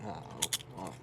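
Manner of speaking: normal talking voice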